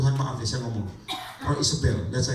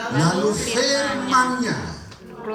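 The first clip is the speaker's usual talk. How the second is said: slow, higher, drawn-out scripture reading; slow solemn Bible reading; 8 semitones higher, 5 dB louder, slower than usual. Acted. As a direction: read the verse slowly for the congregation to follow. Reading scripture aloud together.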